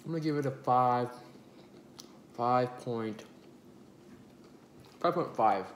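chewing while talking; muffled around food; mouth full of pizza. Real, not acted. Seen 2: Holds pizza; cheeks bulge, jaw working between words throughout.

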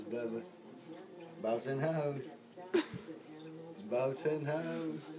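usual speaking voice

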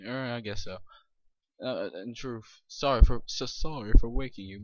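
usual speaking voice

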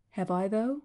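plain reading voice

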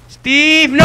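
loud high yell of the name. Real, not acted. Gamer yelling at the enemy player, loud shout.

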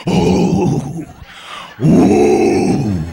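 drawn-out swooning "ooh" of a smitten fan; mock swooning "ooh". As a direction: imitate a woman losing her mind with long oohs. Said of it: "loses her mind".

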